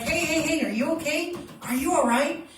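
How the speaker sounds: loud, high calls of hey, are you okay; calling to wake them. Shown as a demonstration. Instructor demos rousing a collapsed victim, loud high urgent calls. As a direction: shout to rouse an unconscious person. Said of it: "So we're gonna be like".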